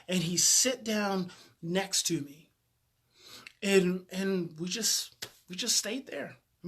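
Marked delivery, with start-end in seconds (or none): none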